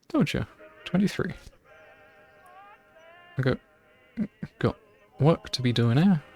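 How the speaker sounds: lightly put-on rural English accent, gruff old gardener; a mild West Country gardener accent, brushing her off; gardener's rural accent. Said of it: "Do that accent?".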